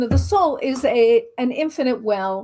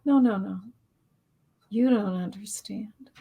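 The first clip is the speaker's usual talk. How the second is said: lower, softer, calm reassurance; gentle spirit voice; 4 semitones lower, 5 dB quieter than usual. Retold or imitated. The records answering her, lower and softly reassuring. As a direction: soothe a frantic person patiently. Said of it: "they're like".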